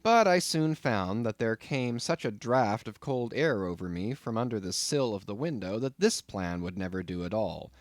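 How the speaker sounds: plain reading voice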